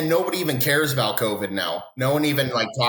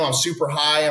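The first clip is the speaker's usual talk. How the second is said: ordinary delivery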